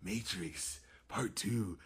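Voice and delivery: soft hushed intro, holding the hush; quiet voice. Real, not acted. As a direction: keep it low and hushed. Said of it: "get into my whisper voice".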